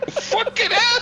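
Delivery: high startled yelp, laughing; surprised shout. Real, not acted. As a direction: yelp in shock, then laugh. Sudden crash in race.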